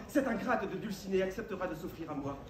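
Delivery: ordinary delivery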